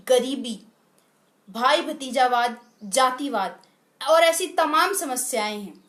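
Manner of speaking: normal talking voice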